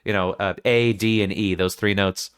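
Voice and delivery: ordinary talk, nothing marked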